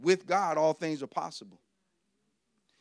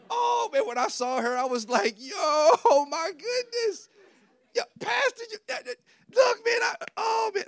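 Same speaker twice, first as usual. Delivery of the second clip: starstruck babbling; 6 dB louder than usual. Retold or imitated.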